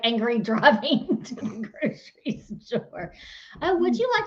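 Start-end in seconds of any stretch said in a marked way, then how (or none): 0.0-3.1 slow, breaking up laughing; words giving way to laughter; laughing tease. Real, not acted. Wife ribbing husband about his angry drive, laughing tease.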